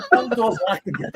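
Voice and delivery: high laughing retelling; laughing quote. Retold or imitated. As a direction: laugh while telling it. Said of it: "I'm like".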